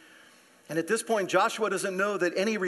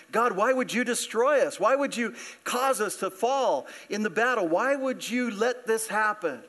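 anguished plea; 5 semitones higher than usual. Acted. Pastor voicing Joshua's anguished prayer.

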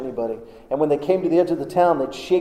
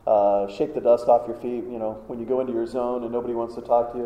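ordinary talk

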